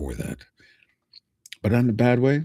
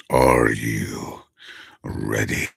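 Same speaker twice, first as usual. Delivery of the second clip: villain voice; 5 semitones lower, much slower than usual. Shown as a demonstration. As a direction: drop deep and draw it out like an evil villain. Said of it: "villain voice".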